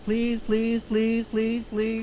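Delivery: high, pleading please repeated; begging. Acted. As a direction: beg over and over, voice up.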